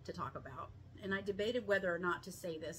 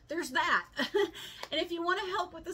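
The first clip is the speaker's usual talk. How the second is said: laughing; 10 semitones higher, 7 dB louder, faster than usual. Real, not acted. Breaks into laughter after sad news.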